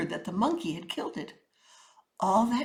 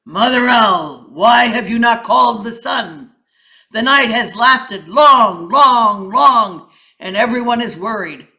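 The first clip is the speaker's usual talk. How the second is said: King Lion; 2 semitones higher, 14 dB louder than usual. A narrator's reading. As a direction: boom it out like a king addressing his court. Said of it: "King Lion asked".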